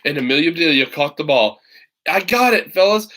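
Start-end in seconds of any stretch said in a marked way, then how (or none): 2.1-3.1 called out. A narrator's reading. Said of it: "she called".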